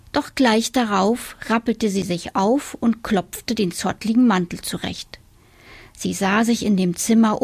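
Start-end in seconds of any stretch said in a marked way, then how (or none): none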